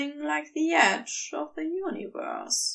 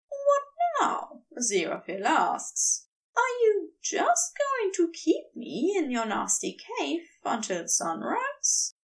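higher, petulant complaint; 6 semitones higher than usual. A narrator's reading. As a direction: sulk at him playfully. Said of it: "the petulance".

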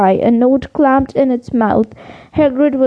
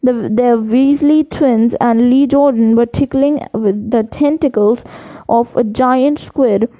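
plain reading voice

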